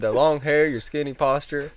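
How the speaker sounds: high, loud, slowing country voice; country accent. Retold or imitated. Customer sizing up the clerk, country accent. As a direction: list the evidence in a country accent. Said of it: "He's got a real country accent".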